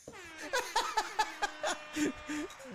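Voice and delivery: high-pitched burst of laughter; laughing. Real, not acted. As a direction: let out a long, high laugh. Laughs at chat messages.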